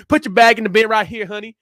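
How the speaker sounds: imitated Texan accent. Repeating the TSA lady's order at Dallas, Texan accent. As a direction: ham up a sassy Texan agent. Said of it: "based off that accent".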